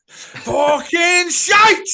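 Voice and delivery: drawn-out, high, very loud scream; screaming fan. Retold or imitated. Fan screaming from the back stand, slow and very loud. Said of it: "some fella going".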